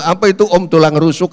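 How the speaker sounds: nada tinggi bertanya keheranan; bingung. Retold or imitated. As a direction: tirukan orang bingung yang bertanya. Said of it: "Dia bingung". (menirukan pertanyaan bingung)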